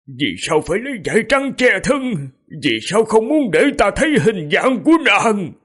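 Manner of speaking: high, anguished questioning; choked with grief. A narrator's reading. King questions his dead beloved, choked high lament. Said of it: "Khương Thái Hương nghẹn ngào, lẩm bẩm".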